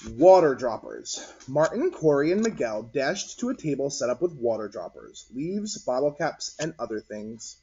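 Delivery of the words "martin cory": ordinary delivery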